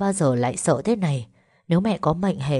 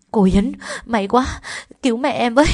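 higher, louder relieved cry for help; urgent, raised-pitch plea; desperate pleading; 7 semitones higher, 4 dB louder than usual. Acted. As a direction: beg the nurse to save mother. Girl begs nurse for help.

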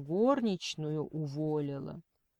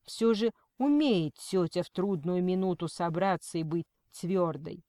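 ordinary delivery

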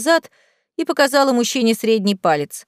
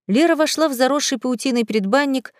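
plain reading voice

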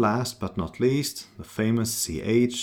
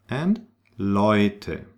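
ordinary delivery

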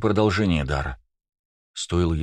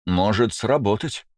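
higher, brightened hope; perking up; 4 semitones higher, slower than usual. A narrator's reading. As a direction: perk up with hope. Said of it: "оживился его величество".

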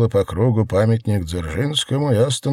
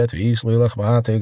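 plain reading voice

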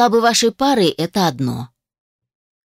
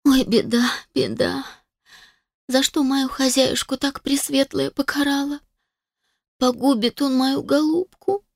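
higher, keening peasant wail; tearful lament; 6 semitones higher than usual. A narrator's reading. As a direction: wail over your mistress like a folk mourner. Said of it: "принялась причитать, обливаясь слезами".

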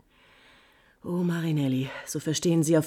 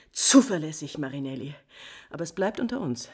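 usual speaking voice

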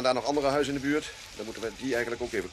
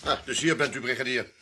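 usual speaking voice

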